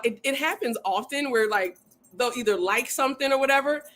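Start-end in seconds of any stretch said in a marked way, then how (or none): none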